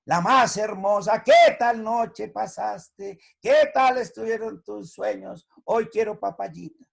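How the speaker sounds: sing-song buttering up; syrupy sweet-talk again. Retold or imitated. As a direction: gush over mamita to get breakfast. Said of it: "Y mañana".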